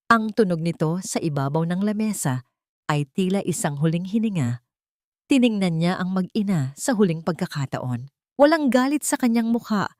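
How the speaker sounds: ordinary delivery, nothing marked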